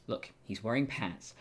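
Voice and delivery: normal talking voice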